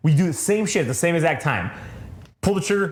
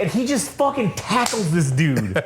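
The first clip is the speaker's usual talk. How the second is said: ordinary delivery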